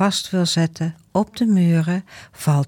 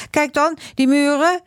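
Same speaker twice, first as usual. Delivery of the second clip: put-on angry boy; 11 semitones higher than usual. Angry boy showing the wall.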